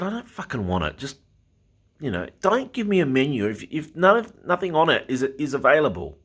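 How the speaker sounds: retold angry retort.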